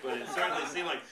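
ordinary delivery

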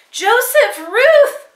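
high, loud cry of alarm. A narrator's reading. A mother spotting her children, high loud cry. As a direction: call out to the children in fright. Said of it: "Mama cried when she saw them".

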